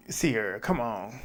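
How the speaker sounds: soft, low coaxing to a friend; a hushed, earnest "come on"; hushed plea. Retold or imitated. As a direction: lean in and plead quietly. Urging Sierra, pleading aside.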